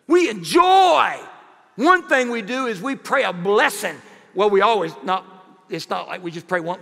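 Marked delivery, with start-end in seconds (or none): 0.1-1.2 slow, loud and delighted; loud joyful shout. Acted. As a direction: call it out with gusto. Savoring the restaurant treat.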